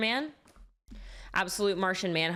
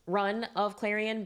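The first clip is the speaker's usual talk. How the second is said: usual speaking voice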